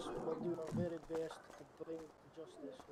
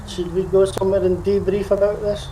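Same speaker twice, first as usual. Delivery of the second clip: loud call; 19 dB louder than usual. Real, not acted. Calling out loudly to group.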